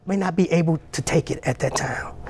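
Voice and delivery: softly whispered aside. Real, not acted. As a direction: drop to a whisper for a moment. Soft aside to the congregation.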